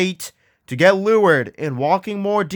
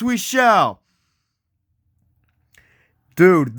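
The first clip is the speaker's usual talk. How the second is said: ordinary delivery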